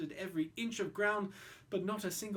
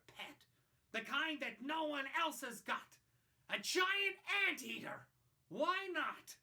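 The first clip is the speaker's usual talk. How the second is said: put-on spoiled boy; 6 semitones higher, 5 dB quieter than usual. Spoiled boy Roy demanding a new pet, bratty. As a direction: whine like a boy who has everything. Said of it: "he stood there shouting".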